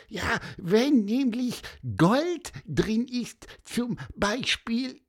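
high, impatient little bird; squeaky bird voice. A narrator's reading. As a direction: chirp and hurry him along. Bird urging friend to open.

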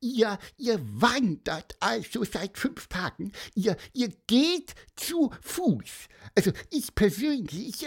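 very high, piping little bird; squeaky bird voice. A narrator's reading. Pieper the bird muses to the children, high piping squeak. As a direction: chirp it out like a small bird.

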